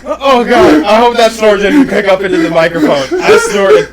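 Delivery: talking through leftover giggles; real, breathless laughter; laughing. Real, not acted. Recovering from laughing fit.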